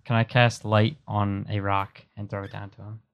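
ordinary talk, nothing marked

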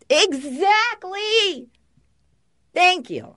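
drawn-out high exclamation; emphatic agreement. Real, not acted. Agreeing loudly with her co-host about chaotic days. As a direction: stretch it out, high and loud, in full agreement.

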